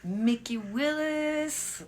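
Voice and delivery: long, drawling American "Willis"; American accent. Shown as a demonstration. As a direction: stretch the name out in an exaggerated American accent. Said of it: "je dis toujours avec l'accent américain".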